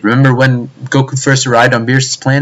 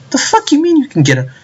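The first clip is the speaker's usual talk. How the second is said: very high, squeaky disbelief; incredulous. Retold or imitated. Fan disputing others' claims, high incredulous squeak.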